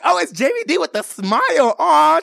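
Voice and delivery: high, sugary mock voice of the desk lady; imitating sweet greeter. Desk worker gushing over Jamie's smile, high sweet voice. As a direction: play her gushing and high. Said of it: "she was like".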